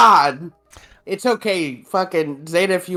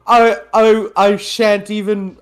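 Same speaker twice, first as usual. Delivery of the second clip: rich snobby person; 4 semitones higher than usual. Pretend. Mocking co-host as millionaire at a burger place, rich snobby voice. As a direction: play a rich snob, pitched up at first. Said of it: "the rich, snobby person voice".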